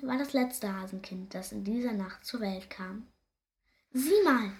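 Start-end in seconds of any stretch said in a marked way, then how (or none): 3.9-4.5 lightly voiced hare mother, higher and louder. A narrator's reading. Mother hare showing father their newborn, lightly voiced motherly call. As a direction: call attention with a raised, lifted voice. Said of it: "sagt die Hasenmutter".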